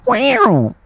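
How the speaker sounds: high vocal imitation of an instrument; mimicked theme-tune wail.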